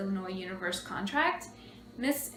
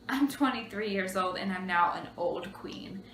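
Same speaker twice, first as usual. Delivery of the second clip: ordinary talk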